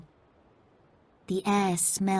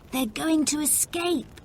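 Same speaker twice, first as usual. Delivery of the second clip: shouting; 8 semitones higher than usual. A narrator's reading. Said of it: "Toby shouted".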